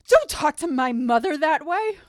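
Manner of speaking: loud, slow, high-pitched scolding; defensive son. Acted. Son defends his mother to the guys, mock outrage. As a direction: stand up indignantly for your mother. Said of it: "And the son goes".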